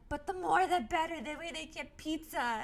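loud high laughing plea; high excited insistence. Retold or imitated. Quoting herself, insisting.

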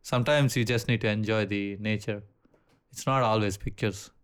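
usual speaking voice

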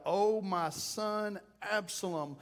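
drawn-out wail for a lost son; grieving cry. Acted. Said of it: "He's screaming it".

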